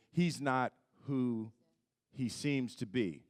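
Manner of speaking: slow, measured delivery with a pause; line as scripted. Retold or imitated. Actor quoting the dramatic line as written, slow and measured. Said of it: "he's supposed to say".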